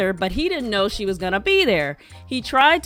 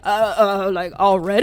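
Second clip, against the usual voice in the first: mock-flustered stammer, louder; 6 dB louder than usual. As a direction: imitate a thrown man stumbling to a startled question. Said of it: "he got all flustered".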